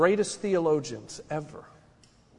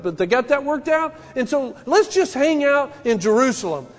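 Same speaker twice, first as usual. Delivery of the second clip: loud, higher, driving pulpit voice; full-force preaching; 9 semitones higher, 7 dB louder than usual. Acted. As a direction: preach it out to the congregation.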